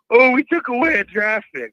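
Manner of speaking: loud, high mocking quote; mock alarm. Caller mimicking the league on a light penalty, mock-dramatic. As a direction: imitate officials making a big deal, voice jumping up.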